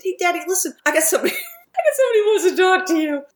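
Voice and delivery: can barely hold in the laugh; laughing through it. Real, not acted.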